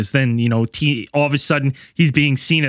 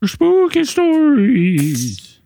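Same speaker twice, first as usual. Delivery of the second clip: spooky filter voice; 9 semitones higher, much slower than usual. Acted. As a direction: stretch it out like a haunted host. Filter voice after the break.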